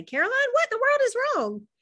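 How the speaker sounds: a mother surprised by tears; mother alarmed to find her daughter crying. A narrator's reading.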